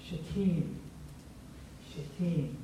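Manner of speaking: Shittim twice, weight on TEEM each time; second-syllable stress. Shown as a demonstration. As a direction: repeat Shittim slowly as shuh-TEEM, with a pause between. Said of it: "pronunciation is emphasizing that second".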